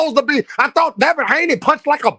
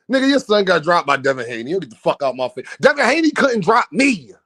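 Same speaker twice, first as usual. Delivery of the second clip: ordinary talk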